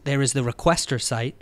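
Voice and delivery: normal talking voice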